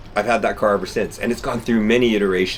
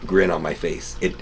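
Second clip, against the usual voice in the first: normal talking voice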